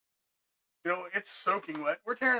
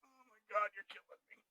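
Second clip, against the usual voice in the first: softer, trailing into a whisper; muttered exasperation; 13 dB quieter than usual. Retold or imitated. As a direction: mutter it under your breath in defeat. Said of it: "I'm like".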